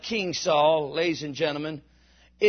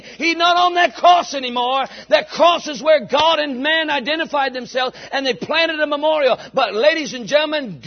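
loud, high, rising preacher's cry; fiery gospel preaching, shouting the resurrection; preaching at full force; 5 semitones higher, 5 dB louder than usual. Acted. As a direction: build the congregation up to a shout. Preaching climax about the resurrection.

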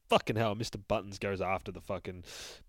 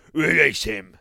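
higher, much louder order; commanding shout; 7 semitones higher, 9 dB louder than usual. Acted. Boogeyman ordering the beast released, loud command. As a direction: bark the order to the guards. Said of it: "says the fucking boogeyman".

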